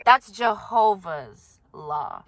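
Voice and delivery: mock elder. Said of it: "mimicking the elder's phrase".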